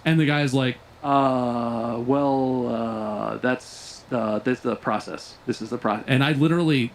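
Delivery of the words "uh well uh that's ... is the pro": low, halting stall with drawn-out uhs; hesitant clerk. Retold or imitated. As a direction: imitate a clerk falling back on procedure. Retold clerk stalls under pressure.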